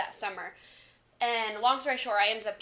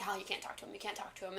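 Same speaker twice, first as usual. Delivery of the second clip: softer, hushed warning to herself; inner warning; 9 dB quieter, faster than usual. Retold or imitated. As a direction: talk yourself down under your breath. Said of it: "And I was like".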